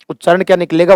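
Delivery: normal talking voice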